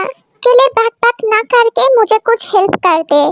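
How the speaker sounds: usual speaking voice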